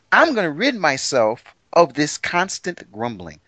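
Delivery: slow, deliberate declaration; voicing God. Host quotes God tired of grumbling, slow and emphatic. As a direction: spell out the resolve word by word. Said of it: "He says".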